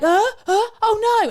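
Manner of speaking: mock panic. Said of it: "you'd think she'd be like".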